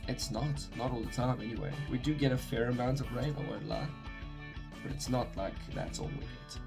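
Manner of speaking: usual speaking voice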